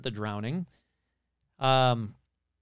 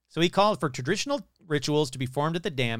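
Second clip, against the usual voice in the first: ordinary delivery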